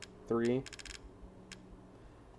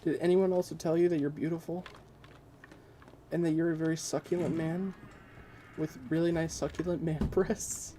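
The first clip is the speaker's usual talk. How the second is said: mock gushing; 7 semitones higher, 4 dB quieter than usual. Gushing mock thanks to Kush.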